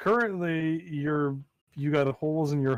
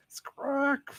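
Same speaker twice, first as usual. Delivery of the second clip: high screeching squawk; bird squawk; 12 semitones higher, 4 dB quieter than usual. Acted. GM voicing the squawk as the hawk flies off, high screech. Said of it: "as the squawk continues".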